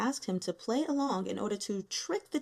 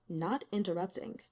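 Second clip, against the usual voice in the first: ordinary delivery, nothing marked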